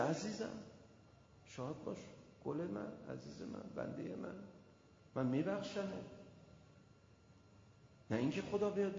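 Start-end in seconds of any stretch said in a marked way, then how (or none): 0.0-5.8 soft, hushed, loving words; tender voice of God. Retold or imitated. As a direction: whisper comfort to a beloved servant. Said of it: "میگه".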